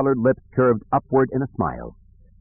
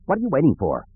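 impatient snap, pitched higher; snapped; 6 semitones higher, faster than usual. A narrator's reading. Fergus snaps at the hesitating stormtrooper, impatient. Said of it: "Fergus snapped at the trooper".

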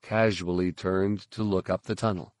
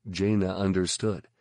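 ordinary delivery, nothing marked

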